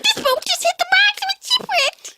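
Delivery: high squeaky cartoon chipmunk. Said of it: "It's really good".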